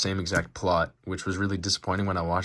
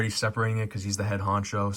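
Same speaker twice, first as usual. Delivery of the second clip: usual speaking voice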